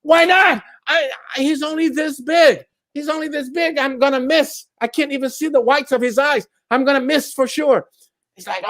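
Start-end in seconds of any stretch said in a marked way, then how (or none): 0.0-0.6 exasperated. Acted. Driver answers guard. 0.9-7.9 nervous guard. Acted. Guard pleads.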